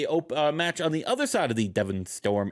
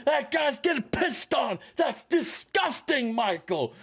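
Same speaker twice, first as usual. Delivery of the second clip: high, shouted commentator outrage; shocked commentator; 11 semitones higher, slower than usual. Retold or imitated. Announcer yells to Michael about the mess, high shout. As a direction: yell it like a disgusted announcer.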